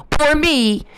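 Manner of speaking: high, whiny mock self-pity; mocking whine. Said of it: "then you whine and complain like".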